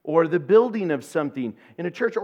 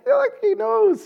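much higher, louder teasing squeak; mock sarcastic aside; 5 dB louder than usual. Wife to husband Mike, a high sarcastic squeak. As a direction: toss it off sky-high to your friend.

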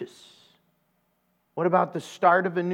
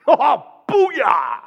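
much higher, louder laughing whoop; mock celebratory shout; 8 semitones higher, 8 dB louder than usual. Bum cheering right in her face, loud laughing whoop. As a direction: blast it out like a drunk cheering. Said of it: "And he goes".

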